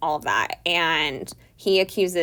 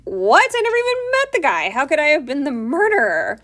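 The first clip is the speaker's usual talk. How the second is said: much higher and louder, outraged disbelief; indignant outburst; 8 semitones higher, 6 dB louder than usual. Retold or imitated. Oedipus denying the murder to Tiresias, higher, louder, outraged. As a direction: play Oedipus exploding at the accusation. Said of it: "keeps getting mad at him".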